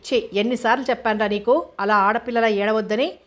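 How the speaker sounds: plain reading voice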